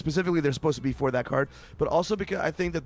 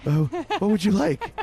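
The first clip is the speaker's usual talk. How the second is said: eager and welcoming; 5 semitones higher than usual. Retold or imitated. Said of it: "they were just like".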